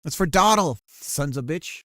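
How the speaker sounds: loud, punched revenge yell; vengeful shout. Acted. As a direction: yell it out as payback.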